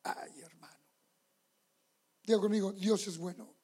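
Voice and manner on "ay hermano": hushed aside. Real, not acted. Whispered aside to congregation.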